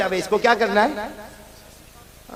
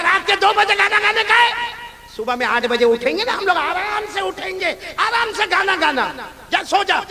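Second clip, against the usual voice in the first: teacher's reply; 11 semitones higher, 4 dB louder than usual. Retold or imitated. Teacher answering child at night.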